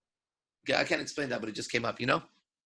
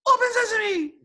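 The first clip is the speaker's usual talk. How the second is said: much higher, thin squeak; squeaky weak voice; 3 dB louder than usual. Retold or imitated. A weak guy at the cave door, squeaky. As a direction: squeak it out timidly. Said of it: "someone goes up and goes".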